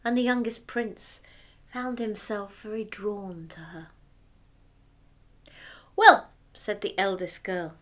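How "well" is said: high, loud haughty princess; cartoon princess. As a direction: proclaim it like a spoiled princess. Eldest girl voiced again.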